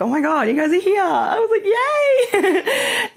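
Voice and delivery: high, loud, joyful squeal; thrilled squeal through a laugh; overjoyed. Retold or imitated. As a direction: greet them with delight.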